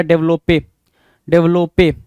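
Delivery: डेवलपे as "dev-lo-pay", extra e voiced; misspelling read out. Shown as a demonstration. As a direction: sound the surplus final e in डेवलपे. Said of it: "ये ई extra दे दिया".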